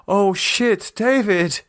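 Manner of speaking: high, urgent calling out for David; a woman shouting for her partner in alarm. Acted. Lisa calling for David through the empty flat, alarmed.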